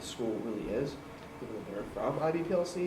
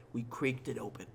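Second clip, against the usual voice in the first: spooky story narration; 9 dB louder than usual. Acted.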